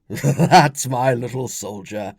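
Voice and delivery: loud, slow, laughing praise; laughing proud father. Acted. Father ruffles his son's hair, laughing. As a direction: chuckle it proudly at a little boy. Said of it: "Vespasian laughed".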